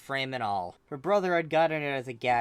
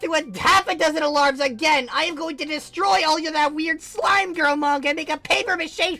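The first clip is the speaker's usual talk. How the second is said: high, loud screaming threat; enraged sister yelling threats; furious threat; 11 dB louder than usual. Acted. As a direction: scream it at the locked door. Threatening brother through door.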